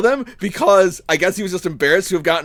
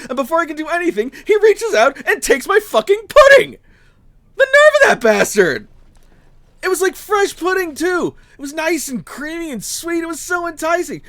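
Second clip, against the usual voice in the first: outraged rant; 10 semitones higher than usual. Acted. Apprentice ranting to a stranger about Gregor, high and indignant. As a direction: spit out the outrage, pitch sky-high. Outraged retelling of pudding theft.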